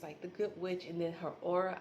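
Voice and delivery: ordinary talk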